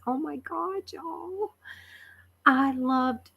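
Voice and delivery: high, soft gush of delight. Real, not acted. Gushing to the chat about talking with Linda, high and soft. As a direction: swoon over it to the chat.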